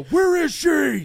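Batman voice. Retold or imitated. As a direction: rasp it out as the Batman. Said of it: "everybody knows the".

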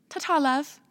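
sing-song British ta-ta; imitating British bus driver. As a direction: wave off a passenger in a British accent. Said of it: "and he goes".

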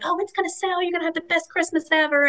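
calm her down kindly; reassuring friend. Retold or imitated. Others reassuring a stressed owner.

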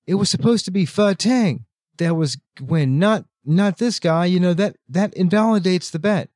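mock outrage, pitched high; high, indignant imitation of angry nobles; retold angry yelling. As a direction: protest like cheated bettors, without real shouting. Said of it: "They're yelling".

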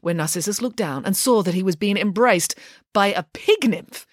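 ordinary delivery, nothing marked

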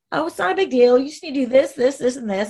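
imitating a dismissive, airy official; breezy and light. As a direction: toss it off as if it's nothing. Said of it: "his words were so breezy and light".

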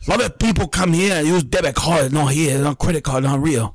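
put-on insistent foreign-accented English; foreign clerk's accent. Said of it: "This is how he spoke, bro".

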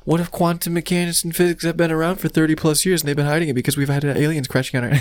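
high, fast excited rambling; excited realization. Retold or imitated. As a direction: rattle it off in a rush of discovery. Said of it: "just like".

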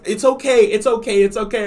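hammed-up reassuring voice; soothing girlfriend. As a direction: imitate a frantic comforting girlfriend. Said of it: "Layla was like".

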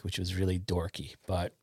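ordinary talk, nothing marked

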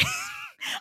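short high-pitched giggle; high squeaky laugh. Real, not acted. Laughs at own guess.

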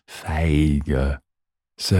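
ordinary delivery

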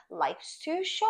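high, stretched-out final word; milk the life lesson, slowly; playfully overdone motto, rising high; drawn-out moral. Pretend. Mock-solemn lesson of the week.

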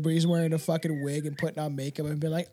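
normal talking voice

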